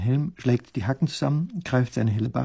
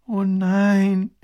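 long, high groan of a princess; groaning dismay; 8 semitones higher, 6 dB louder than usual. A narrator's reading. Princess hearing why he still stands there, groaning. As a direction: groan it out loudly. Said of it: "stöhnt die Prinzessin".

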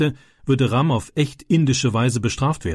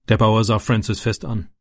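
ordinary delivery, nothing marked

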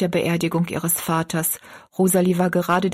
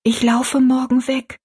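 voiced, higher secret-telling, no real whisper; confiding girl; 6 semitones higher, 3 dB louder than usual. A narrator's reading. Rosalie revealing her escape to her friend, higher-pitched. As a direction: confide it in a raised girl's voice. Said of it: "flüsterte Rosalie".

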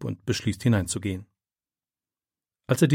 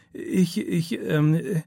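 put-on halting, higher, scared boy; boy caught by his teacher, stammering higher; 6 semitones higher, much slower than usual. A narrator's reading. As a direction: trip over the words in fright. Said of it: "stammelt er".

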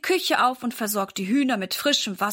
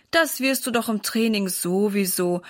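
plain reading voice

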